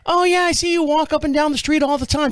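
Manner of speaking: imitating her in a high, even voice; neighbor's wife. Neighbor's wife greeting the walker, imitated in high voice. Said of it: "the wife comes out".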